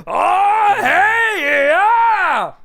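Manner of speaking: high, loud goofy yelling voice; Adam Sandler yell. Retold or imitated. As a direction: shout it like a goofball comedian. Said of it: "waiting for Adam Sandler to pop up".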